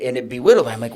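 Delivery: ordinary talk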